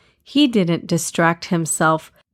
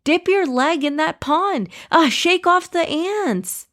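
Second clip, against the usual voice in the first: high, urgent yell without much extra volume; acted yell; 10 semitones higher than usual. A narrator's reading. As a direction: yell urgent orders at a friend in danger. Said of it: "and yelled".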